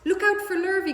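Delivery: high, loud warning call; cows calling. A narrator's reading. Cows warning Wilbur about Lurvy. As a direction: shout the warning across the yard. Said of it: "called the cows".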